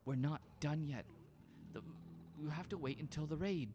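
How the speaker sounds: a hint of a firm but sorry leader; lightly voiced Linnea. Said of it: "Linnea's like".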